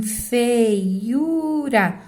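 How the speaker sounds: FEIURA stretched slowly, diphthong "fei" held in one syllable before "u"; drawn-out syllables. Shown as a demonstration. Teacher showing students the weak diphthong in feiura, syllable by syllable. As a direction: sound out feiura slowly, keeping "ei" together. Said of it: "antecedido desse ditongo aqui".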